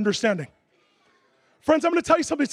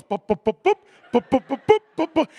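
imitated belly bups; 3 semitones lower than usual. Preacher mimics the big man's belly bouncing, playful bup sounds.

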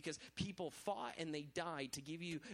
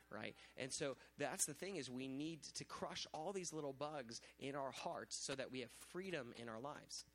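usual speaking voice

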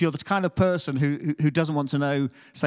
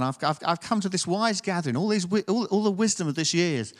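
ordinary delivery

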